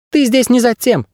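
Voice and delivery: high, thin creature voice; squeaky bird guide. A narrator's reading. Bird guide correcting his ward, high squeak. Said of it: "ответил пернатый".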